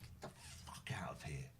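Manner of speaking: a hissed, breathy dismissal; whispered get out to the caller who annoyed him; seething whisper. Real, not acted. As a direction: whisper it with contempt. Host dismissing caller, dropped down.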